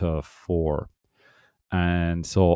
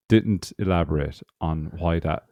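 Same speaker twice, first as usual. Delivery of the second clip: ordinary delivery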